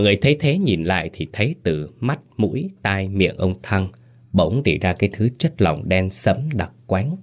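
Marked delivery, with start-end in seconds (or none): none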